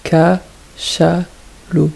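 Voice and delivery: L'éléphant said slowly with every syllable clear; drawn out for the children; teacher pronouncing a word slowly for the class; slow, careful word. Shown as a demonstration. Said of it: "retrouver la syllabe".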